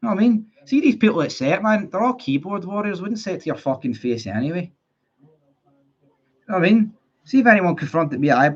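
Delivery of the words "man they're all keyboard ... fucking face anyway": usual speaking voice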